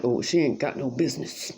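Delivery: a lightly voiced nosy neighbor; mock neighbor voice. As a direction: mimic her with a low sneer. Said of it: "She doesn't talk like that".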